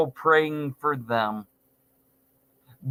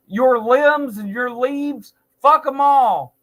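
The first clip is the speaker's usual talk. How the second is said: shouted curse; 5 semitones higher, 3 dB louder than usual. Real, not acted. Cursing the tree's limbs.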